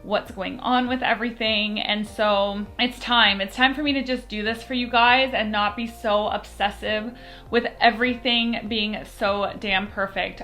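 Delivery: ordinary delivery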